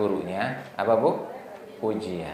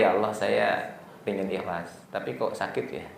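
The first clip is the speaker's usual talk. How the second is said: a hint of a hurt, quiet plea to God; tearful prayer; 3 semitones lower, 8 dB quieter than usual. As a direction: softly confess the pain, barely holding back tears. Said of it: "Terus kita menangis".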